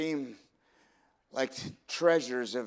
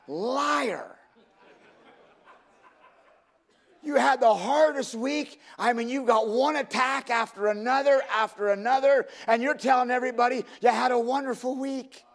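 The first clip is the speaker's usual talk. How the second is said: high-pitched preacher's rebuke; fervent, exposing the pretence; impassioned preaching; 10 semitones higher, 4 dB louder than usual. Real, not acted. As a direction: call out the congregation, voice raised high. Preaches accusingly at congregation.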